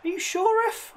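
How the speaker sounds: high, disbelieving question to the ref; you can't be serious, ref; incredulous. Real, not acted. Questioning the referee's call.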